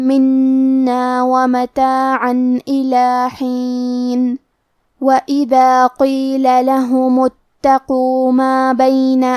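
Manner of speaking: slow melodic tajweed chant; measured Quranic recitation. Shown as a demonstration. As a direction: recite the verse slowly with held vowels.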